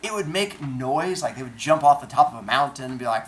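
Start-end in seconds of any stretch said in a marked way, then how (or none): none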